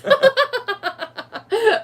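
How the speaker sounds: bursting out in high laughter. Real, not acted. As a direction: laugh out loud, delighted. Laughing at Gonzo's reveal.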